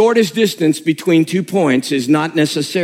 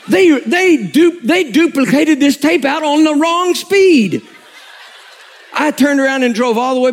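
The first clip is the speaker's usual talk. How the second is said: laughing; 8 semitones higher than usual. Real, not acted. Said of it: "laughing while telling".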